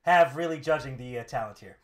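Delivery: loud opening, settling into dry sarcasm; mock praise; sarcastic. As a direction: feign being impressed. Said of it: "uh, sarcastically".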